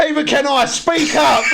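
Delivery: high, drawn-out retold call; mock calling out. Retelling the courtroom exchange to a friend, mock calling out. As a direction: call out slowly through amusement.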